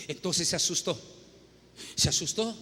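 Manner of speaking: normal talking voice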